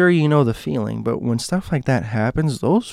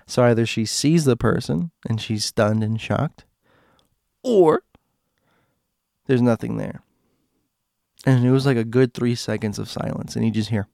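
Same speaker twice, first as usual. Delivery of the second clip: usual speaking voice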